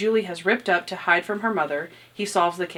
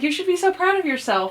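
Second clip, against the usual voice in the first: higher, encouraging imitation of Natalie; Natalie praising; 9 semitones higher than usual. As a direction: praise him like a proud coach. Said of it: "And she's like".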